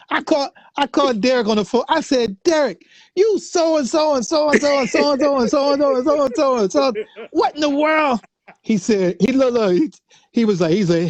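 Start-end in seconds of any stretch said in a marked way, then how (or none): 2.4-8.2 high-pitched, mock-exasperated rant; lightly hammed-up chewing out a friend; mock scolding rant. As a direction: bandmate playfully chewing him out over the phone. Scolding Derek on the phone.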